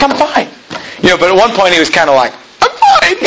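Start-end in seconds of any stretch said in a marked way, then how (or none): 0.0-0.5 high strained reply; strained I'm fine. Retold or imitated. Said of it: "He kinda said it in a weird voice". 2.6-3.2 very high squeaky I'm fine; weird voice. Retold or imitated. Boy insisting to his host he is fine, very high squeak. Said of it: "He kinda said it in a weird voice".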